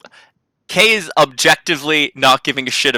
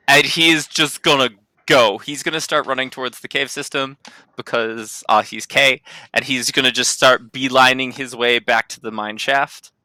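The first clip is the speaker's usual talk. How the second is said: ordinary talk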